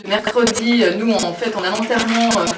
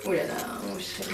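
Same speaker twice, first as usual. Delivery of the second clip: whispering; 11 dB quieter, faster than usual. Real, not acted. Soothing the baby in bath.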